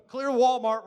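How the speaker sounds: loud, high call to clear the store; mock announcement. Preacher jokes about clearing out a crying Walmart, mock announcement.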